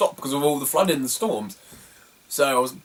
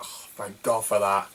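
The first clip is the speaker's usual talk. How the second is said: relieved sigh; 6 semitones lower, 6 dB quieter than usual. Retold or imitated. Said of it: "I'm like".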